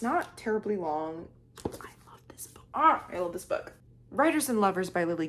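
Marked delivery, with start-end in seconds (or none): 1.6-2.6 soft whispered gush; hushed adoring whisper. Real, not acted. Whispered gush about book. 2.7-3.7 breathy gush. Real, not acted. Repeat louder, breathy.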